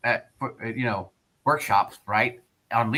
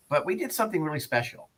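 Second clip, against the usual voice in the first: ordinary talk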